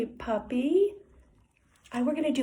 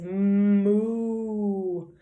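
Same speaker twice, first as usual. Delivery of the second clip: long, drawn-out "moo"; cow moo; 3 semitones lower than usual. Shown as a demonstration. Teacher showing children what the cow says, drawn-out moo. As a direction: moo slowly like a cow, holding the sound. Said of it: "Cow says".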